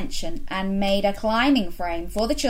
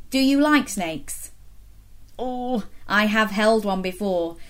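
plain reading voice

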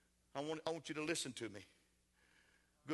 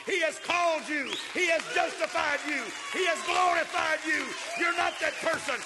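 loud, high, impassioned shouting sermon; emotional preaching; 12 semitones higher, 12 dB louder than usual. Real, not acted. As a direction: pour real feeling into every line, crying it out. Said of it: "Forgive me for getting emotional here tonight".

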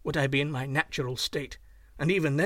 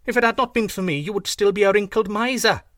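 much higher, louder indignant goose; angry goose; 5 semitones higher, 8 dB louder than usual. A narrator's reading. Goose Chang scolds the young man, higher louder anger. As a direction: scold him loudly. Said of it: "hissed the goose angrily".

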